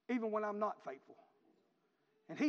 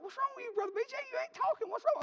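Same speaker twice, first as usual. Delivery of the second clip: put-on curious young man; 14 semitones higher, faster than usual. Quoting the boy in the truck.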